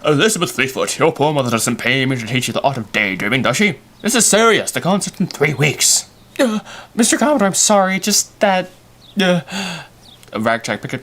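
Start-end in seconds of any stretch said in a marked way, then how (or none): 0.1-6.1 hammed-up telling-off, pitched up and pushed hard; Mister Commodore. Teacher scolding a daydreaming pupil before the concert, hammed-up scolding. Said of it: "I'm not playing Mister Commodore". 6.4-9.4 high, loud, rushed apology; hammed-up flustered pupil, pitched way up; Betty Fleetfoot. Pupil apologizing to a scolding teacher, hammed-up fluster. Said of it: "All right, Betty, uh, Fleetfoot".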